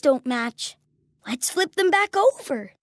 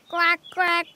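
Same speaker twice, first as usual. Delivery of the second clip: two short duck quacks; duck quacking. Pretend. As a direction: imitate a duck's quack. Animal sounds after matching game.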